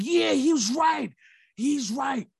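rally behind him; crowd cheering. Retold or imitated. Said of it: "Everybody was like".

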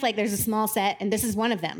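usual speaking voice

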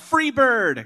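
high, drawn-out concert call; mock heckler yell. Heckler calling out a request at a concert, mock yell. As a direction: holler it like a heckler, without real volume. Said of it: "people just yelling out".

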